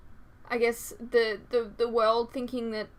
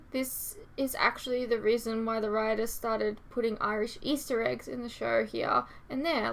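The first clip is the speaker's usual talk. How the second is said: ordinary delivery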